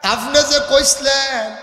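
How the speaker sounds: loud, high-pitched cry to a father; calling out. Acted. Son calls to his father in anguish, calling out. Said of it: "ডাক দিয়া কয়".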